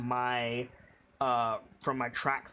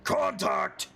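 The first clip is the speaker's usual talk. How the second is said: higher orc shout from the back of the throat; orcish shout; 7 semitones higher, 4 dB louder than usual. Shown as a demonstration. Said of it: "let your vocal cords vibrate a little bit more".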